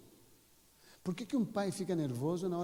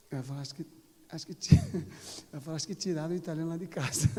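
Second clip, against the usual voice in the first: breaking up with laughter mid-quote; laughing while talking; 4 dB louder, faster than usual. Real, not acted. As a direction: try to get the joke out through laughter. Cracks up retelling wife's joke.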